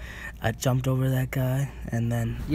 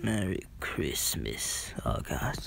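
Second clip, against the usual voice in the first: slow soft whisper; 8 semitones lower, 8 dB quieter, slower than usual. Real, not acted. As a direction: breathe it out slowly. Says greeting twice, then whispers.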